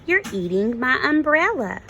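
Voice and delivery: lightly voiced small bird; little bird voice. A narrator's reading.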